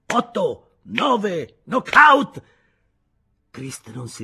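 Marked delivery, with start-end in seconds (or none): 0.1-2.4 high, loud referee's count; mocking referee count, rising to a yell on knockout; mock boxing referee. A narrator's reading. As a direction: count him out like a ringside referee. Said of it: "imitando un arbitro di boxe".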